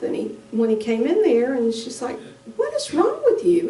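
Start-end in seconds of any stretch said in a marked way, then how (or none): 2.6-3.7 high, worried cry of alarm; high alarmed sister voice. Retold or imitated. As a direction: sound frightened for her brother.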